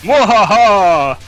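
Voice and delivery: slow hammy evil laugh, loud and high; mad scientist cackle. Pretend. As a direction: ham up a villain's mwahaha. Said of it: "she should play that off and just be like".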